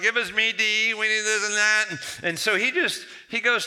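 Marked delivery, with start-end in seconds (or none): 0.0-1.8 high, loud whining crowd; whining demand. Acted. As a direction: demand it like complaining Israelites. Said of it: "They're whining and complaining".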